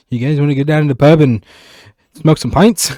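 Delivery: put-on, not-quite-Irish accent; attempted Irish accent. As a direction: have a botched go at Irish pub talk. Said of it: "That wasn't Irish".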